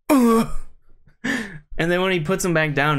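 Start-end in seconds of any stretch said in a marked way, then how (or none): 0.1-0.5 loud, high strained grunt; imitated grunt. Chiropractor's patient grunting as he's lifted. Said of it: "he's like".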